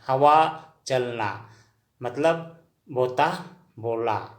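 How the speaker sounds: slow, drawn out, each word set apart; slow dictation. Shown as a demonstration. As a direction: read it out slowly so learners can note it. Teacher dictating a vocabulary word.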